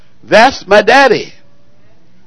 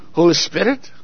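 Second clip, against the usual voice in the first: Holy Spirit said softer and lower, in a mock refined accent; mock sophisticated accent; 3 semitones lower, 8 dB quieter than usual. Shown as a demonstration. Preacher mocking the congregation's fancy "Holy Spirit" with a sophisticated accent. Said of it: "With an accent".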